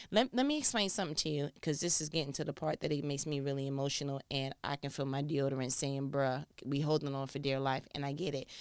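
low, soft, quick aside; confiding. Real, not acted. Explaining to listeners that this gets emotional, confiding.

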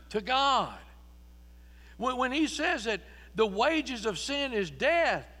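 loud, high, driving preacher's cry; full-force preaching. Acted. Preacher to congregation, the devil's charge in a loud preaching cry. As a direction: preach it out with rising fire.

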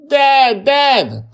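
put-on child's high, loud scream. A narrator's reading. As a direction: scream out for your father. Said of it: "Tommy is screaming".

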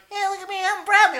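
mocking high-pitched voice; exaggerated high impression; Bradley. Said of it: "how m-, high my voice is for my age".

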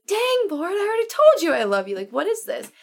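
high, exasperated mock protest; flustered Peter. Peter fed up with Jesus asking again, flustered. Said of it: "Peter gets flustered with him".

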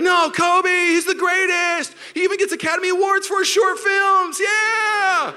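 high, loud protesting cheer; hammed-up fan raving, stretched words; fanatical fan. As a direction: insist wildly that your hero is best. Voices fanatical Kobe fans.

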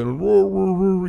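high, loud toy roar sounds; playful growly toy roar; imitated doll roar. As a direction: mimic the Chewbacca doll roaring. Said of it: "this little doll that moved".

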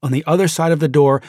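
ordinary delivery

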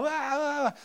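put-on high whiny baby wah-wah; mock toddler wail. Father mimicking his crying toddler, high mock wail. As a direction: imitate a little kid wailing. Crying kids in meltdown mode.

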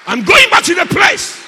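high, shouted declaration; loud, high preacher's shout; Peter's resolve. Acted. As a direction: cry it out with full resolve. Acts out Peter's resolve to return.

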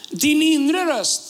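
slow, stretched key phrase; slow and weighty, stress on inre; slow emphasis. Acted. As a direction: draw out Din inre röst slowly. Preacher repeating key phrase.

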